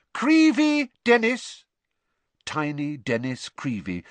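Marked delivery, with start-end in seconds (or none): 0.1-1.6 loud, high, stretched roll call; calling out names. A narrator's reading. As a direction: summon the pupil slowly and loudly. Teacher calls out name.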